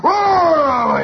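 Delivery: long, loud, high bellow. Retold or imitated. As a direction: imitate a wild kid bellowing. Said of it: "he bellows like that".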